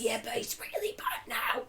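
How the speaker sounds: very soft, strained wrecked-voice imitation; Steve-O. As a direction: push out a ruined voice, barely there. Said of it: "His voice is fucked".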